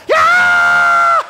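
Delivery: sudden high shrieking yell; old man in church jolts awake with a shriek; screaming guy yell. Retold or imitated. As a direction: let out a huge sudden scream of "Yeah!". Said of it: "screaming guy".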